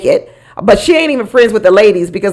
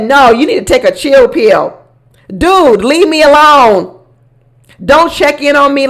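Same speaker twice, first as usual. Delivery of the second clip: snippy brush-off; 5 semitones higher, 3 dB louder than usual. Retold or imitated. Quoting Michelle's rude brush-off.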